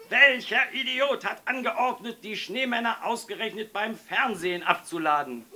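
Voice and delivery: high, loud angry tirade; enraged boss yelling; furious bellow. A narrator's reading. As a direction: bellow the outraged question down the phone. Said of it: "brüllte er in die Muschel".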